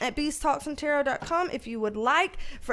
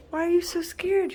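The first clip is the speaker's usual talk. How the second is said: ordinary talk, nothing marked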